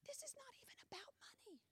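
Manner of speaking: secretive repeat under the breath; whisper. Shown as a demonstration. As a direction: lean in and whisper the point. Says line, then whispers it.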